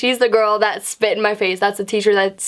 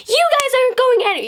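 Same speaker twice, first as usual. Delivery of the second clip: high, shrill-sounding mock lecture, much higher; mocking the teacher. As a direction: squeak it out like a ranting teacher mimicked.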